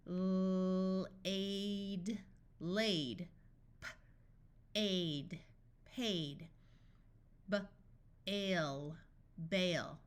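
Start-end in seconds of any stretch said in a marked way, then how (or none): 0.1-2.2 long held L running into "aid"; sounded out. Shown as a demonstration. Phonics teacher sounds out, then blends. 3.8-5.4 puffed P, pause, then "aid"; sounded out. Shown as a demonstration. Phonics teacher sounds out, then blends. 7.5-9.0 short B, pause, then "ale"; sounded out. Shown as a demonstration. Phonics teacher sounds out, then blends.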